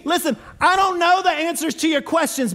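ordinary talk, nothing marked

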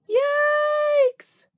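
very high, loud yikes; a sudden yelp as the kayak tips; startled yelp. Acted. Kayak tipping over.

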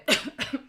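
coughing fit; real cough; chesty cough. Real, not acted. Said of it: "przepraszam za mój kaszel".

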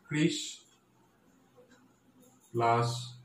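"Krishna" broken up as "কৃষ", "ন", with long gaps; syllable by syllable. Shown as a demonstration. Teacher splitting Krishnanagar for students, syllable by syllable. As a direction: isolate each piece of "Krishna", saying "plus" between. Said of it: "ভাঙলে কি হবে".

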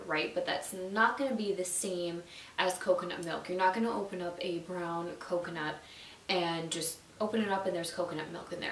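ordinary talk, nothing marked

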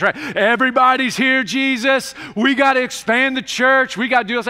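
loud high rushed pleading; excited disciples. Acted. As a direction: burst in with big news for Jesus.

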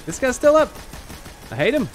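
real angry outburst; outraged yell. Real, not acted. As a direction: shout at the enemy. Enemy survives, outraged.

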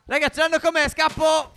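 high, loud cry while fleeing; excited high-pitched alarm. Real, not acted. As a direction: shout to friends as you flee. Fleeing attackers in the game.

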